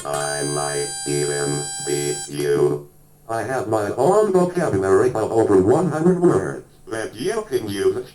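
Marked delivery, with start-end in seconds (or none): 0.0-2.9 low, slow monotone robot voice; different voice. Shown as a demonstration. Speech module teasing the player, low different voice. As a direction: drone it out low and slow on one note. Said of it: "I can speak in different voices".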